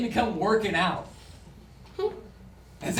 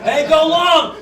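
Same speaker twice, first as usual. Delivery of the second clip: calling out; 8 semitones higher, 12 dB louder, slower than usual. Acted. Acted football call.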